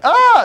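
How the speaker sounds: high, loud cry of shock; startled gasp. Retold or imitated. Neighbours watching the cat jump out, startled cry. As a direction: yelp as if seeing a cat leap.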